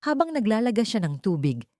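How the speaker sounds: usual speaking voice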